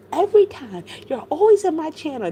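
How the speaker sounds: high, loud imitated complaint; mock exasperated quote. Vlogger retells Allie complaining about her channel, mock exasperated.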